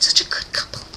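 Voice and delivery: breath-only swooning whisper; hushed gush over a favorite couple. Real, not acted. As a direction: whisper it, overcome with fangirl delight.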